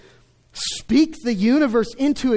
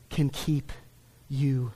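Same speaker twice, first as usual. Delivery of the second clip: usual speaking voice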